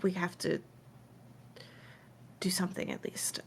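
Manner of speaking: very soft, half-whispered urging; hushed voice meant for one listener; whispering. Acted. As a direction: murmur it privately, with pauses. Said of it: "Mayfair kinda whispers to her".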